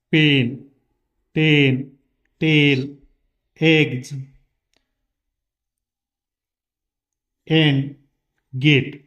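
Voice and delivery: "पेन" "टेन" "गेट" each said slowly and clearly with a short open e; English short e demo. Shown as a demonstration. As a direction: model each English word with a crisp short e. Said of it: "यामध्ये ए हे उच्चार असणारे बघा". (साफ़ छोटे ए के साथ अंग्रेज़ी शब्द)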